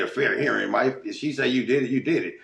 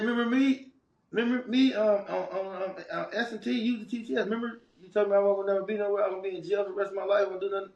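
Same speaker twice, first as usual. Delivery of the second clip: high, fast, excited taunt; gleeful taunting; 11 semitones higher, faster than usual. Retold or imitated. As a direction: rub it in, grinning. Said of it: "I clowned him".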